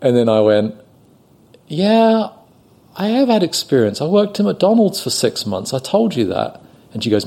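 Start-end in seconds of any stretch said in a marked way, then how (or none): 1.7-6.6 high-pitched, indignant self-defense; defensive protest. Retold or imitated. Waiter insisting to an angry customer he has experience, defensive. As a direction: protest your credentials in a raised pitch. Acting clueless waiter to scolding woman.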